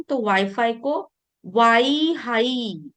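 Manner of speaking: वाई हाई said slowly, with ह in place of फ; teacher showing the Japanese वाई हाई; slow pronunciation contrast. Shown as a demonstration. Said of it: "उसकी जगह हा लिया".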